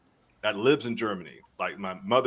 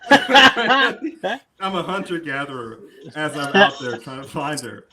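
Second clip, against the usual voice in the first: ordinary talk, nothing marked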